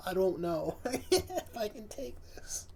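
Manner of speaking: laughing whisper. Real, not acted. Reactor overcome by laughter.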